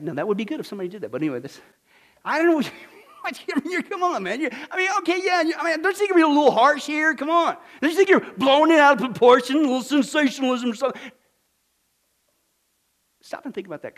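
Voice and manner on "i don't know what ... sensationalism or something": higher, fast protesting churchgoer voice; mock critic. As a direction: play the doubter pushing back on the pastor. Voicing an imagined critic.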